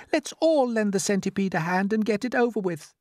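high lady's voice; practical ladybird. A narrator's reading. Ladybird organising help for centipede, high and brisk-minded. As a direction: urge them on in falsetto.

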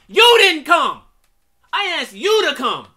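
loud, high-pitched shouting; indignant full-voiced shout; angry rebuttal. Real, not acted. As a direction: yell the blame right back. Shouts back at the character's blame.

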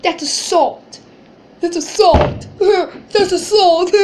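loud, high whiny sobbing imitation; mock crying. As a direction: wail it between fake sobs, mocking a crybaby. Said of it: "He gets up crying".

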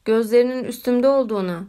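ordinary delivery, nothing marked

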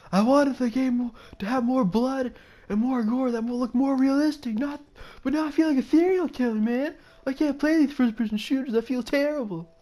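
high, loud whining parody; mock complaining gamer. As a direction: wail it like an overwrought player full of regret. Acts gamers complaining about realism.

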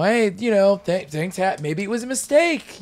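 hammed-up wishful excuse, pitched high; mock pleading. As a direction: plead it high, half-joking. Acting out a sceptical, mocking objection.

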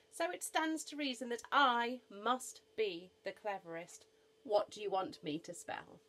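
loud, confident boast; boastful elephant. Acted. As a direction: state it as beyond argument.

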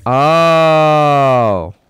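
loud, high cheer of triumph; triumphant shout. Real, not acted. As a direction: whoop it out, then crow loudly. Triumph at a move in game.

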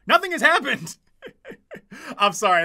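normal talking voice

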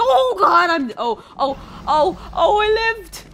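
high, loud burst of alarm; game panic. Real, not acted. Panics as dragons attack in game.

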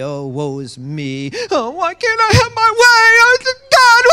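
high, loud, whining mock self-pity; whiny pity party. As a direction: ham up a sulking baby. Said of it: "it's a pity party".

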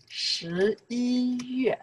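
slow, high, held syllables; drawn-out demonstration. Shown as a demonstration. Teacher modelling the month in Chinese, slow and held. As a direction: stretch the month out for learners.

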